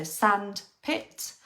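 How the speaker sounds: "sandpit" stretched very slowly, jaw dropping on each syllable; very slow demo. Shown as a demonstration. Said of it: "hand under your chin and say the word".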